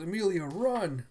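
high, loud acted yell; acted cry of alarm; urgent warning shout. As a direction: shout for someone to flee. Cat attacks, shouts a warning.

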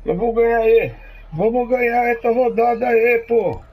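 high, slow hyped voice; excited. Real, not acted. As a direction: pump yourself up. Hyped for the last round.

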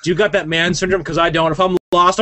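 ordinary talk

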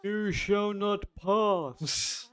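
slow, booming wizard command; Gandalf. Pretend. Friend urged to go Gandalf, booming wizard line. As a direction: draw it out like Gandalf barring the bridge. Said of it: "go all Gandalf on their asses".

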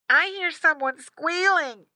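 put-on high, loud boy piglet; high excited piglet voice. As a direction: pipe up as a young pig. Boy pig voiced in story.

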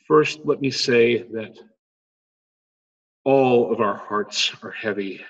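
normal talking voice

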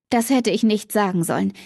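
ordinary delivery, nothing marked

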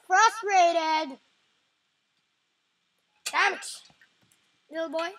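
ordinary talk

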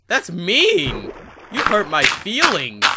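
high, loud fake-offended whine; mock hurt whine. Teen retelling his fake hurt reply to the girl, hammed-up whine. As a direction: pout it like a wounded kid. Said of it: "I was like".

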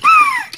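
sharp high gasp of fright; mock-scared gasp; startled gasp. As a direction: gasp like a scared little goat. Goat startled by troll.

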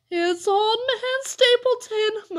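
high, loud, slow wail; terrified wailing; frightened moan. A narrator's reading. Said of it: "moaned Amos".

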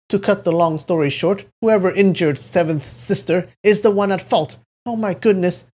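high-pitched, indignant questioning; agitated sworn brother. A narrator's reading. Sworn brother confronting whoever injured seventh sister, agitated.

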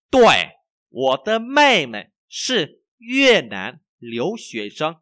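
very slow, loud, word by word; language teacher's model reading. Shown as a demonstration. Teacher reads for learners to repeat, slow model reading. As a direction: exaggerate each tone, high and clearly spaced.

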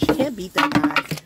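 excited pitch jump. Real, not acted. Hyping a twenty-dollar price.

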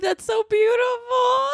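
mock gushing. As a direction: gush over it sarcastically. Mock gushing at friend's phrase.

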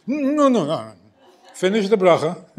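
playfully urging him to finish; excited boy pressing with a laugh; eager insistence. Retold or imitated. Boy urges man to finish blessing.

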